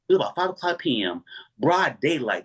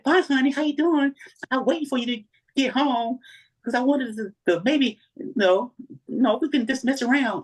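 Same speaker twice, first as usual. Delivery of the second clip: high, sugary wife voice; mock seductive wife; sweet wife; 12 semitones higher than usual. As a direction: purr it like a too-sweet wife.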